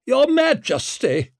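high, loud, slow formal greeting; imperious, furious salutation; contained fury. A narrator's reading. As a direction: greet the king through barely held anger. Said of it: "bespoke barely contained fury".

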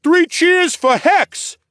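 high, loud, drawn-out cheer; calling out. A narrator's reading. Taller guard calls the crowd to cheer Hex. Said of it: "the taller guard called out".

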